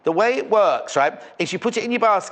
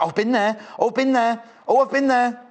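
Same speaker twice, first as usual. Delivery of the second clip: rising excitement, each repeat higher; excited gushing; 5 semitones higher than usual. Retold or imitated. Presenter imitating Hannah's excited recognition. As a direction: gush with growing recognition. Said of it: "she's like".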